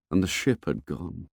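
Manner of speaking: ordinary delivery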